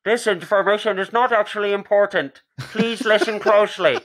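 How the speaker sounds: high, loud put-on announcement; mock announcer voice. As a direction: ham up a pompous announcer. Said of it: "They're like".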